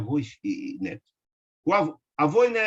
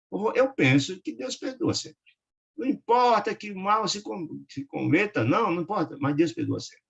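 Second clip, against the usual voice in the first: put-on childlike simplicity; 7 semitones higher than usual. A narrator's reading. Neto consola o avô doente, voz infantil simples. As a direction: speak it simply like a small child. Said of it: "com toda a simplicidade infantil".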